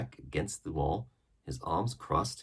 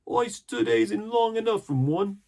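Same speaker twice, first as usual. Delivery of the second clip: much higher, louder crewman; another pirate; 8 dB louder than usual. A narrator's reading. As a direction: grumble like a sullen sailor. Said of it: "added another".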